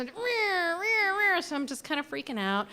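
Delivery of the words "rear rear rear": high whining mock engine noise; imitated engine cranking. Car still won't start, mock engine whine. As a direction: mimic the dying engine again.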